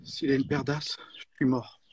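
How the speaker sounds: quiet, tense worried mutter; stressed. Acted. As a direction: sound nervous and cornered. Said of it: "tu dois me dire en étant stressé".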